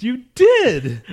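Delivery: high, loud comeback; indignant contradiction. Real, not acted. Storyteller contradicting co-host's denial, indignant. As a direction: insist on it, pitch shooting up. Arguing with co-host over spoiling.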